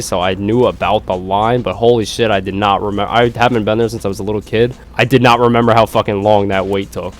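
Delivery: ordinary delivery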